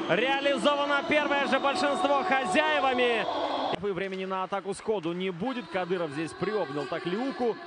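usual speaking voice

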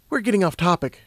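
lightly acted redirecting; lightly voiced Lumina. A narrator's reading. Lumina steering the unicorn back on topic, impatient.

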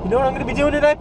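playful falsetto teasing viewers; silly high voice. Pretend. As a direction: ask it squeaky and high. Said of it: "Why am I talking like this?".

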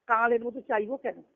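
ordinary delivery

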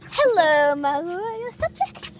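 royal greeting, slowed; warm regal boom. Pretend. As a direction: queenly voice. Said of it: "Stop it".

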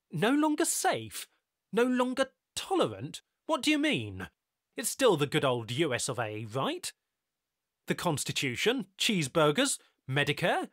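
high, loud alarmed questions; alarmed disbelief. A narrator's reading. Tyler reacting to news about Earth, alarmed and high. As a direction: voice jumping up in disbelief.

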